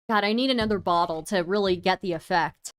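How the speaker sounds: ordinary delivery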